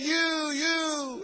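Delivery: high voice calling out, echoing away. Retold or imitated. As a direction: call it from afar, letting it echo. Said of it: "and it echoes".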